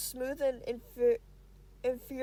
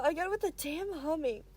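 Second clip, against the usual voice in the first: ordinary delivery, nothing marked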